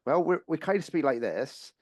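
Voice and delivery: mock West Country accent. Host jokingly imitating West Country speech for radio guests, in a West Country accent. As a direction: poke fun at the West Country way of talking. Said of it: "And then you get to Bath and Bristol and it's like".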